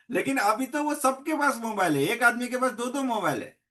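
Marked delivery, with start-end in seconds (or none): none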